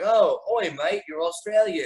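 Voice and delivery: high-pitched put-on Aussie greeting; hammed-up Australian accent; mock Australian. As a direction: imitate someone hailing an Australian mate. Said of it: "they went like".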